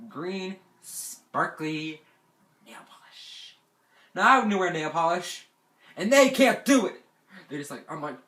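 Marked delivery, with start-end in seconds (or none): 2.7-3.6 hushed, whispered reveal; dramatic whisper. Retold or imitated. Camper revealing what was on their neck, dramatic whisper. 4.2-7.0 loud, high-pitched outrage; indignant outburst. Retold or imitated. Camper outraged by the nail polish prank, loud high indignation.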